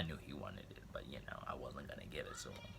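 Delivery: fast whispered confession; sneaky hushed whisper. Real, not acted. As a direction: let them in on it quietly.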